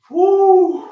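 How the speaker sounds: high, loud "woo"; exerted whoop. Real, not acted. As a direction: let out a high whoop after the set. Whoops after finishing a set.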